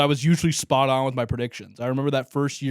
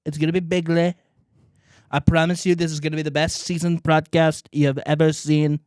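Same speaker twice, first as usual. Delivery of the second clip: higher, boastful rally-speech voice; Trump impression; 3 semitones higher than usual. Wrapping up the Trump intro, boastful impression. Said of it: "I think that's a pretty good Trump".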